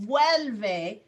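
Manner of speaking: "Vuelve" said firmly and clearly in two syllables; teacher's careful, emphatic "Vuelve"; pronunciation model. Shown as a demonstration. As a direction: model "Vuelve" distinctly for an unsure learner. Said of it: "You're just uncertain about pronunciation".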